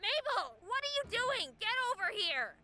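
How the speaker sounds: high-pitched mock yell across a lake; frantic mom calling her daughter; yelling lady. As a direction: call for a lost kid. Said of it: "point of view of this lady".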